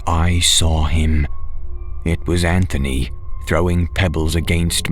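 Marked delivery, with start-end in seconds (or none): none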